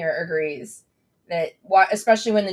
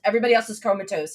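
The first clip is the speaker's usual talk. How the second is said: normal talking voice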